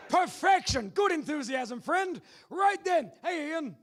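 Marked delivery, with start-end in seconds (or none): none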